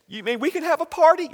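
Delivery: put-on high, hopeful little boy; lightly voiced child asking eagerly. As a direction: pitch up like an excited five-year-old.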